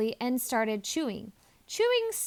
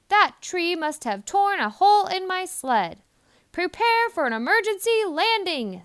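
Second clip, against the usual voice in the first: higher, louder alarmed Santa; urgent Santa; 7 semitones higher, 6 dB louder than usual. A narrator's reading. Santa orders the reindeer to land urgently. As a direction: call the order in a hurry.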